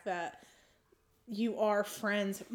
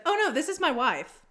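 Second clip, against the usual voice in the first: quicker, higher, lightly imitated polite correction; nice correction; 7 semitones higher, 6 dB louder than usual. Imitating wife correcting a stranger in line, nicely. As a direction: imitate her putting him right without the drama. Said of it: "like that".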